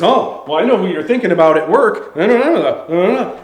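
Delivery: loud, sing-song mock squabble; mock bickering. Boyfriend sniping back at his girlfriend, mock bickering. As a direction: bicker back into wordless gibberish. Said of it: "kind of bickering back and forth".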